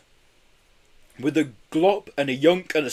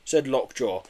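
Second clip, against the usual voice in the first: plain reading voice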